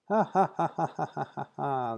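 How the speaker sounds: high fake laugh fading to soft; mock laughter for the joke; cartoon laugh. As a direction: ham up a laugh that trails off. Playback, both characters laugh.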